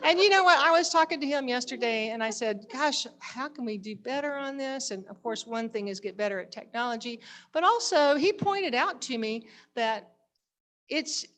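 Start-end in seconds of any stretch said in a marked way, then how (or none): none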